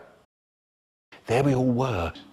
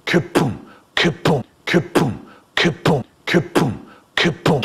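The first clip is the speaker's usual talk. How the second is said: mouth explosion noises. Acted. Bazooka blasts.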